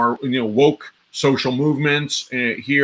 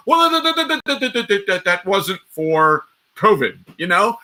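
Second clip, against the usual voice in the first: mock panicked official, much higher, tripping over that; flustered stammer; 6 semitones higher than usual. Senator catches official with documents, higher flustered stammer. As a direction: stammer like a caught-out bureaucrat.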